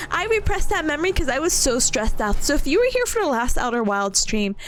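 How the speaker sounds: usual speaking voice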